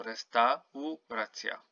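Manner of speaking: restauracja said slowly, every vowel pronounced, c as ts; every vowel sounded. Shown as a demonstration. Teacher to student, slowly sounding out restauracja. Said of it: "You have to pronounce each and every vowel".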